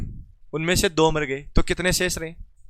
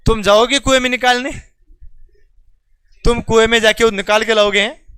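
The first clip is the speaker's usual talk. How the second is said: mocking teasing question; 5 semitones higher, 7 dB louder than usual. Real, not acted. Teacher mocks answer to class.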